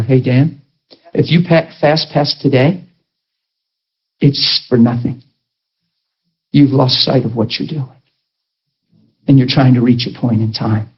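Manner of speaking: lightly voiced fatherly God, low and measured; God fathering. God fathering the preacher mid-fast, low fatherly voice. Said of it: "He's there to father me".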